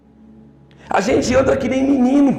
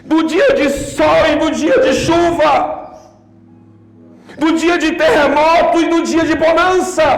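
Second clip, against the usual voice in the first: full-force preaching; 5 semitones higher than usual. Acted. Preacher proclaims to the congregation that God does not change, shouting high.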